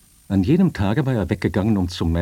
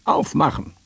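high-pitched forceful command; commanding soldier's yell; powerful guttural shout; 8 semitones higher than usual. A narrator's reading. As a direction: shout it as a demand through a locked door. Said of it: "schrie eine kräftige, gutturale Stimme".

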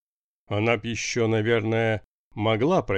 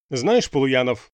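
furious boss, louder; enraged; 3 semitones higher, 6 dB louder than usual. A narrator's reading. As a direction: snap his name in rage. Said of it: "взъярился редактор".

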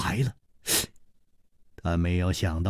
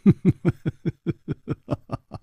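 long chuckle, high and trailing down; mischievous drawn-out snicker; amused, self-satisfied chuckling; gleeful giggle; 6 semitones higher than usual. A narrator's reading. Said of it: "笑嘻嘻地瞧着她说".

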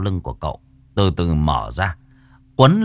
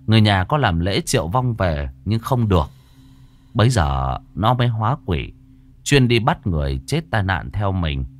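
plain reading voice

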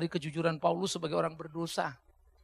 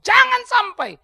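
high, loud preaching shout; loud warning; 14 semitones higher, 12 dB louder, slower than usual. Real, not acted. Preacher warning the congregation in hardship, shouting high. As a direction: hit the warning hard.

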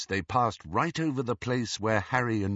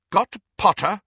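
sharp; 10 semitones higher, 7 dB louder than usual. A narrator's reading. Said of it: "said Professor McGonagall sharply".

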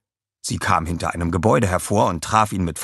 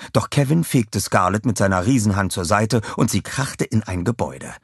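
ordinary delivery, nothing marked

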